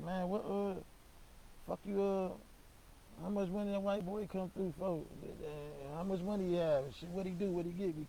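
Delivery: eager questioning. Retold or imitated. Eager nosy questioning of cousin.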